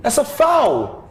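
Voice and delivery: drawn-out, rising protest at the ref; pleading with the referee. Real, not acted. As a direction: stretch it out, appealing to the ref.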